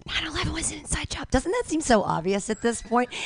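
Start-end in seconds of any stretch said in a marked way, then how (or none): 0.1-1.3 softer, higher conspiratorial aside; confiding a conspiracy to the audience, hushed. Acted. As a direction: lean in and confide it. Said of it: "even whispering it".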